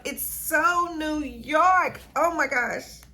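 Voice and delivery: loud, high, drawn-out rave; gushing delight. Real, not acted. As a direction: belt it out slowly, stretching SO and YORK. Third repeat, peak of delight.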